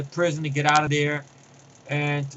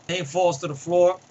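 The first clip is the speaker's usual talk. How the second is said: ordinary talk, nothing marked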